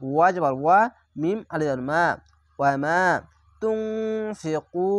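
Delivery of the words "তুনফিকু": তুনফিকু with nasal ghunna and the ku stretched; Quranic recitation. Shown as a demonstration. Teacher recites the word for students, drawn out. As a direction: recite তুনফিকু slowly, drawing out each sound. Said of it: "এখানে দেখেন".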